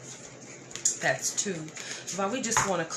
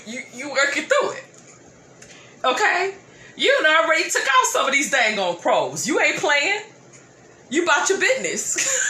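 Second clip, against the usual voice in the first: high, loud, laughing encouragement; laughing hype; 8 semitones higher, 6 dB louder than usual. Real, not acted. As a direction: cheer them on through laughter. Hyping up Capricorn, laughing.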